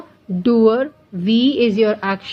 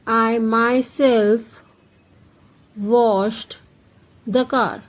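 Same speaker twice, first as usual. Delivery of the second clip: slow dictation; much slower than usual. Shown as a demonstration. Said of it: "teacher dictates example sentence".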